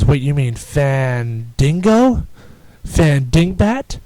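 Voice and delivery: Fandingo and Fandingbat said slowly, deliberately mangled; mock mispronouncing. Scene: Jericho mocking Fandango's name in interview, slow mangling. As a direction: drag out Fandingo, Fandingbat like a taunt. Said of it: "he just keeps saying, like, stupid crap like that".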